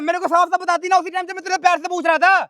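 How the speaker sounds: high-pitched, agitated accusation; worked-up husband arguing back; heated retort. Acted.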